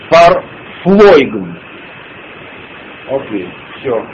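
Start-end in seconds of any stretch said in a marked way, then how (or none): 0.1-1.6 фарфлойген drawn out very slowly, louder; stressed word demo. Shown as a demonstration. Teacher repeating the word for stress, slow emphatic фарфлойген. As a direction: stretch фарфлойген out with strong, loud stress. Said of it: "Ударение".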